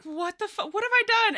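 panicked. Acted. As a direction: freak out mid-swear. Sheldon panicking at Theo's words.

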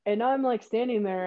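ordinary talk

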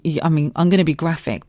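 ordinary talk, nothing marked

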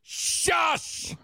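mock scolding.

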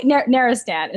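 Narastant tried as "NAIR-uh-stand"; American pronunciation. Shown as a demonstration. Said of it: "if you're American".

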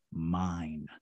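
low, drawn-out ghostly word; deep voice. Retold or imitated. Unseen presence claiming the man behind it, deep voice. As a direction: imitate a deep, looming presence. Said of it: "this deep voice".

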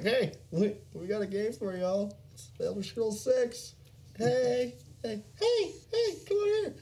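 high, rising to very high calls of "hey"; mock eager pitchman. Mocking a pitch of Elder Scrolls six: high eager barker calls. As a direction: beckon like a carnival barker.

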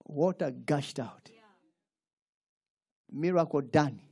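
ordinary delivery

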